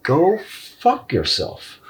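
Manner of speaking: slow, high, contemptuous brush-off; a slow, sneering go fuck yourself; drawn-out dismissal. Retold or imitated. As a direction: stretch it out with scorn. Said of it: "It's like".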